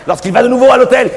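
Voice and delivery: ordinary talk, nothing marked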